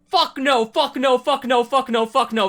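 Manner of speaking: loud, high repeated angry shouts, fading; yelling across the room. Real, not acted. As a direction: shout it down again and again. Rejects idea, repeated shouting.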